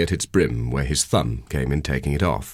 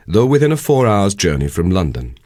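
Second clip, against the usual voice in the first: ordinary delivery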